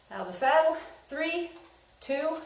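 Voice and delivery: breathless coaching shouts; cycling hard. Real, not acted. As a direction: yell encouragement mid-sprint. Spin instructor counting into sprint.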